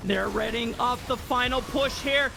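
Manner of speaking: high, shouted championship call; victory call. Real, not acted. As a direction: roar the final moments home. Game-winning call.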